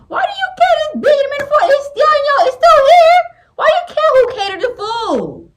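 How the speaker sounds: high, loud exasperated outburst; irritated rant. Real, not acted. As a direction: shriek it in disbelief at the husband. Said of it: "I'm getting irritated already".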